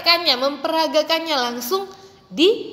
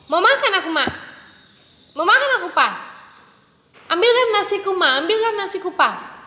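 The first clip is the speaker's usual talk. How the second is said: put-on high, pushy childish demands; bossy little kid; rude demanding child; 7 semitones higher, faster than usual. Shown as a demonstration. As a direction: whine like a spoiled kid ordering his parents. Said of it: "bukan ungkapan yang sopan".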